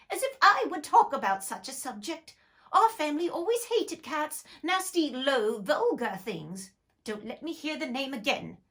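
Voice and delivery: high, loud indignant mouse; furious mouse. Acted. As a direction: rant in outrage as the mouse.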